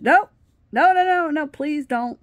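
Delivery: high, loud retold panic; panicked pleading. Stopping the boyfriend who stood up, panicked pleading. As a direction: burst out begging him to stop.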